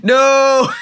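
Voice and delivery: high, loud drawn-out "No!"; long wailed shout of refusal; shouted protest. Real, not acted. Mock protest at the booze idea.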